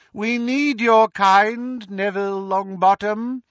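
Voldemort gloating. Acted. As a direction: gloat over the fallen hero to the crowd. Voldemort recruits Neville.

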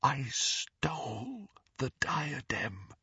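slow, hushed, breath-held confession; whisper. A narrator's reading. Ghost repeats her confession to Harry, whispering. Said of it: "in a whisper".

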